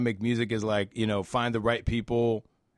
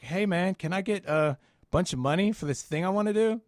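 casual ask; 9 semitones higher than usual. Retold or imitated. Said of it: "calling up a business and being like".

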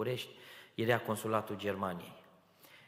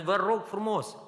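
high, loud, tearful begging; tearful plea; 11 semitones higher, 8 dB louder than usual. Retold or imitated. Young man begging the clerk in tears, high loud plea. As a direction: plead through tears, voice pushed up high. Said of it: "dar eu plângeam".

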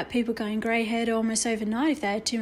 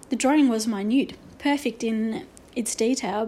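ordinary delivery, nothing marked

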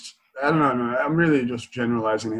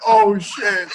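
higher, louder startled "Oh, shit" with the "Oh" jumping up; the barber caught off guard, "Oh" thrown up high and loud; surprised oh shit; 8 semitones higher, 5 dB louder than usual. Retold or imitated. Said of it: "he went".

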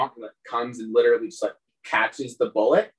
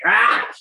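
short, high, loud yelp; sudden acted yelp of pain; pained cry; 11 semitones higher, 7 dB louder than usual. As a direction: cry out as if kicked in the face. Game master voices kicked goblin's cry.

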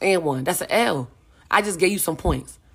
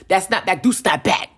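a little louder, punchy proverb; punchy street saying with a grin; snappy comeback line; 3 dB louder than usual. Shown as a demonstration. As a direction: snap it out like a quick comeback. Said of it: "watch how you say it for sure".

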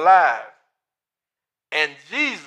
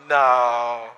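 ordinary talk

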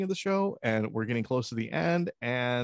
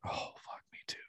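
breathy whispered dismay; 15 dB quieter, faster than usual. Retold or imitated. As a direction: whisper it in mock alarm. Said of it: "I'm like".

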